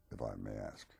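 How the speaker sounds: ordinary delivery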